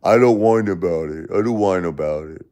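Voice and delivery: sneering mimic of the commenter, lightly voiced; mocking imitation. Streamer mocks the commenter Chill Guy in a sneering voice. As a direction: parrot his words back with contempt. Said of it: "pieces of shit like Chill Guy here".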